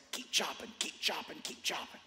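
soft, whispery repeated push; hushed urging. Acted. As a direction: urge them on under your breath. Urging persistence, hushed repetition.

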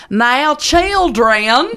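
high, very slow drawled Southern teacher voice; exaggerated Southern twang. Shown as a demonstration. Teacher drawling at the class in exaggerated twang. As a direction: stretch children out in a thick twang. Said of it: "'cause I don't, I say the whole word like this".

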